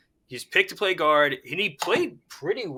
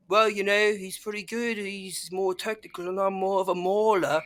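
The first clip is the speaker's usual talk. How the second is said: put-on amateur New Zealand accent, a bit higher; lightly put-on Kiwi accent impression; Mailata's New Zealand accent; 4 semitones higher, 4 dB quieter than usual. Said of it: "in a New Zealand accent".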